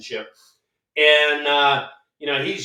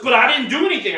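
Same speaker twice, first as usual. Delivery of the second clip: high, louder mock whine; protest, pitched up high; protesting innocence; 8 semitones higher, 6 dB louder, faster than usual. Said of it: "And he goes".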